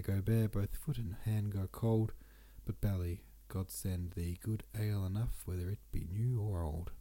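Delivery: ordinary talk, nothing marked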